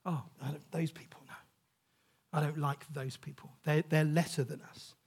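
low, quiet mock snobbery; mock disdain. Imitating people who look down on others, mock disdain. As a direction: put on a hushed, lightly disdainful judgement.

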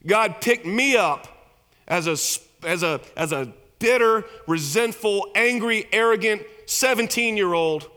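loud, high, driving sermon voice; full-force preaching. Acted. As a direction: preach it hard to the congregation.

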